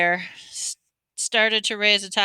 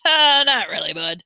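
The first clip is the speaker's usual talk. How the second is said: amused dismissal; 5 dB louder than usual. A narrator's reading. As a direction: brush it off with a chuckle. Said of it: "He laughed".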